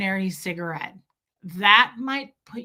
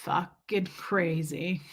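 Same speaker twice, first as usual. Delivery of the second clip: slow, softer smoker's mutter; feigned chill; 3 semitones lower, 5 dB quieter, much slower than usual. Pretend. Unbothered worker with imaginary cigarette, slow feigned chill. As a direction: drag it out slowly between imaginary puffs.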